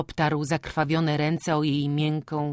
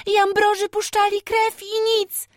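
put-on young girl voice; 5 dB louder than usual. A narrator's reading. Girl explains to father.

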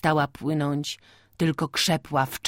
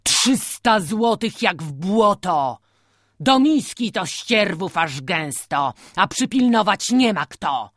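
put-on angry old farmer; 4 semitones higher, 5 dB louder than usual. A narrator's reading. Old man rages over cow.

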